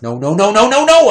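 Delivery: retold panic, building to a yell; panicked shouting. Retold panic at pregnancy news.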